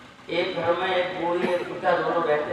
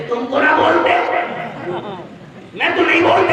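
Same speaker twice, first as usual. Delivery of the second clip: put-on stubborn old wife; 9 semitones higher, 6 dB louder than usual.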